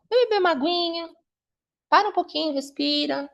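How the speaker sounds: high, sing-song coaxing; acted mum calming a nervous daughter; soothing mother.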